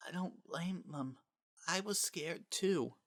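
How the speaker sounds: put-on a frightened little boy barely speaking; weak small child. Azriel confesses his fear.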